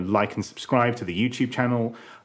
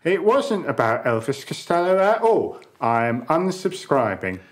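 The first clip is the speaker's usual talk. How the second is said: indignant listener voice; 5 semitones higher, 3 dB louder than usual. Acted. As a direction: read it like a huffy fan letter. Mock angry listener letter.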